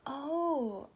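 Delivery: ordinary delivery, nothing marked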